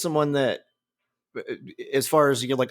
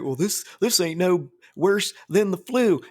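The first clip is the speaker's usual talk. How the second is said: put-on Southern accent; 5 semitones higher than usual. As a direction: play a dim Southern yokel in a West Virginia accent. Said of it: "a fucking Southern or West Virginia accent".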